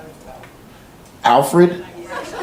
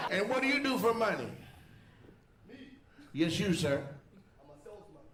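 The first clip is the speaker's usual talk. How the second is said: ordinary talk, nothing marked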